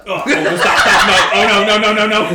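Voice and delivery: loud, high burst of protest; laughing panic. Real, not acted. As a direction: blurt it out loudly through laughter. Player panics mid-message, laughing.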